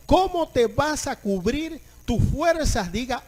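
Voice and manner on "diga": normal talking voice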